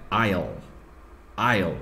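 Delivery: "I'll" said slowly as one clear syllable; careful contraction demo. Shown as a demonstration. Teacher modelling "I'll" for students, careful and clear. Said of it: "presta atenção na minha pronúncia".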